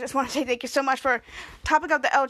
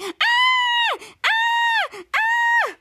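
repeated high shrieks, a bit louder; high-pitched screams; 4 dB louder, much slower than usual. Retold or imitated. Host imitates Tiny Ray screaming, repeated high shrieks. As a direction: shriek over and over in panic. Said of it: "high-pitched scream".

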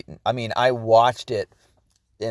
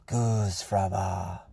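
low, soft, very slow drawn-out mantra; calming mantra; 5 semitones lower, 7 dB quieter, much slower than usual. Pretend. Man calming himself after coffee rant, slow soothing chant. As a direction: stretch each syllable to soothe yourself.

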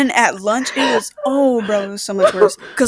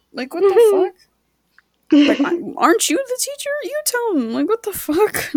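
mock indignant rant; 8 semitones higher than usual. Ranting at teacher, acted retort.

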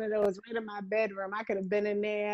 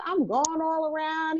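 ordinary talk

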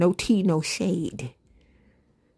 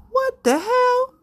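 much higher, slow mock squeal; squealed disbelief; slower than usual. Reacting to [name]'s Pumps and a Bump, high mock squeal. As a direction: squeak it out like you can't believe it. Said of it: "then we was like".

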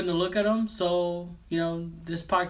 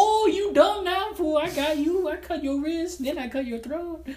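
taunting knife fighter; 11 semitones higher, 4 dB louder than usual. Retold or imitated. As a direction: gloat over the beaten victim. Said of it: "then he's just like".

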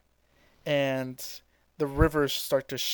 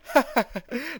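villain laugh; 10 semitones higher than usual. Retold or imitated. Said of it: "Frieza's like".

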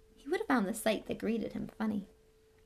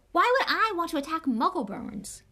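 much higher, loud indignant cry; fierce shout; 10 semitones higher, 8 dB louder than usual. Acted. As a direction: hurl the question in disbelief. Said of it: "said Harry fiercely".

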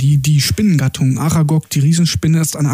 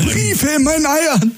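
higher, slow, spelled-out repeat; exasperated repeat; 10 semitones higher, slower than usual. Retold or imitated. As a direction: repeat it slowly and fed up. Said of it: "dieses Gespräch so nachgeahmt".

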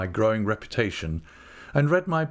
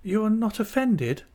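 timid Dora; 12 semitones higher than usual. A narrator's reading. Dora anxiously asks her aunt.

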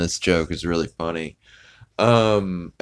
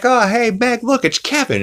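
much higher, cheery mock dad voice; excited calling out. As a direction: imitate an oblivious dad spotting someone. Voicing Peter calling out to Meg.

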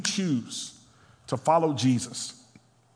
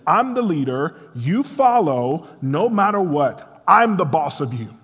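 firm-handed boss; 3 semitones higher, 6 dB louder than usual. Acted. Rulers lording it over others, acted firm-handed bossiness. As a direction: lay down the law, phrase by phrase. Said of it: "they have a firm hand".

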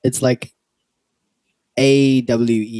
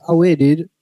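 casual greeting; 6 semitones higher, 4 dB louder than usual. Retold or imitated. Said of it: "if I'm saying hi to someone".

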